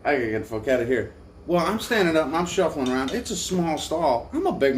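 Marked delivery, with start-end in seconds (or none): 0.0-1.1 hurried panic. Retold or imitated. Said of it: "I was like".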